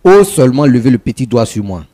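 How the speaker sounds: loud, high, daring warning; angry threat. Real, not acted. Daring the rival to lay a finger, angry threat. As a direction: dare them to try it, loudly. Threat after near fight.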